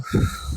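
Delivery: short nervous chuckle; nervous laugh. Real, not acted. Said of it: "Yes, that was a nervous laugh".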